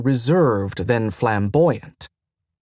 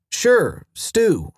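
higher, bright humoring tone; humoring lightly; 7 semitones higher than usual. Acted. Mentor humoring the confused station, higher and bright. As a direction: go along with him brightly.

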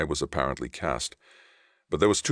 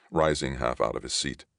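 usual speaking voice

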